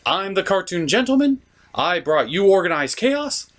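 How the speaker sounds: ordinary talk, nothing marked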